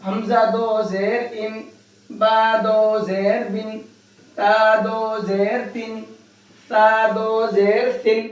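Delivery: slow: ان، بن، تن، سن with the ن sounded; slow drill for students; slow tanween drill. Shown as a demonstration. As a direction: spell it out slowly like a Quran teacher. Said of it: "اسی طرح سے پڑھنا ہے".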